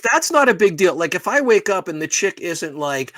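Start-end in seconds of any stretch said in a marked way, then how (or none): none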